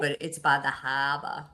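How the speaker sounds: put-on a quick, light Boston accent, dropping the r in harbor. Cohost slips a joking aside into the retreat plug, in a mock Boston accent. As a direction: tease the harbor line in a playful Boston accent. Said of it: "that's my Boston, uh, accent, uh, try".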